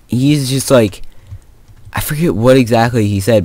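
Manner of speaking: ordinary talk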